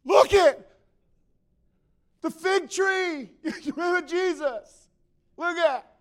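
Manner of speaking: high, shouted amazement with laughter; hammed-up excited disciple; over-excited Peter. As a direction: yell it giddily, demanding a look. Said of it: "Peter goes".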